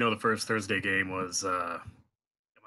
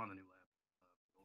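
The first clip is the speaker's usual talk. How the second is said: barely audible; 29 dB quieter than usual. Real, not acted. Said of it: "it did it again".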